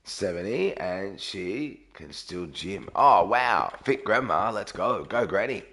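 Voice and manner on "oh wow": ordinary talk, nothing marked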